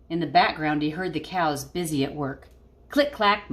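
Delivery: put-on lively read-aloud voice for kids; storybook reading. Teacher reading a picture book to her class, lively.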